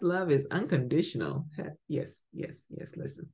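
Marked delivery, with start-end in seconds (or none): none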